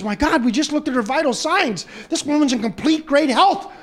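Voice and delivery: high-pitched, excited announcement; amazed doctor. Acted. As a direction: deliver astonishing news with rising excitement. Doctor amazed at patient's recovery.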